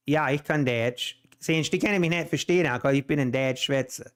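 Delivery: native Pennsylvania Dutch speech. Shown as a demonstration. Showing listeners their original language, in Pennsylvania Dutch. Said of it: "That was Pennsylvania Dutch".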